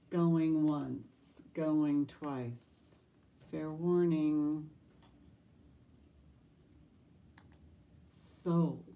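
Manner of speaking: loud auctioneer countdown; auctioneer call. Acted.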